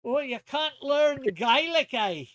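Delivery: high, loud hammed-up Scottish brogue; mock-pity in a thick Scots voice; Scottish accent. Teasing friend who failed a Gaelic lesson, in a Scottish accent. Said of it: "do Scottish voice".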